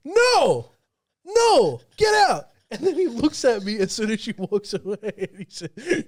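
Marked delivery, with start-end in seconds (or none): 0.1-2.4 loud, high mock shout; emphatic refusal. Miss Love turns down the girl's lift, imitated shouted refusal. Retold friend rejecting girl's request.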